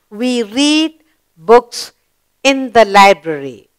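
very slow, deliberate reading; slow read-aloud. Shown as a demonstration. Teacher rereading the sentence to pupils, very slowly. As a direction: stretch it out word by word. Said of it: "we completed the sentence".

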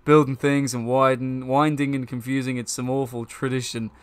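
loud, emphatic complaining game character. Acted. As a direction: voice a character grumbling loudly, punching words.

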